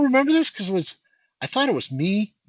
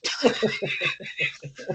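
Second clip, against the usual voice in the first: breathy laugh; 10 semitones higher, 6 dB quieter than usual. Real, not acted. Laughs at the punchline.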